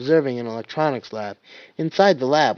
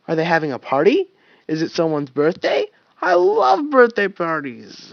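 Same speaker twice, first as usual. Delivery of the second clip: higher, louder excited chatter; 4 semitones higher, 5 dB louder than usual. Acted. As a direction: gush with childlike excitement. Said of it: "getting very excited".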